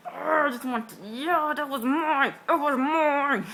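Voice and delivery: slow, high, loud sulking claim; mock mad. Jealous fan claiming the wave, mock mad. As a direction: drag it out, loud and indignant. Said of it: "I was too mad".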